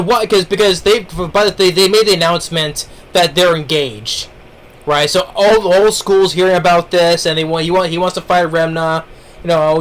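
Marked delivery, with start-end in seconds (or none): none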